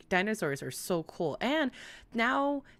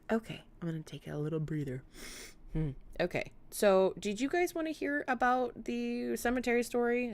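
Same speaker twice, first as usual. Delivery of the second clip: normal talking voice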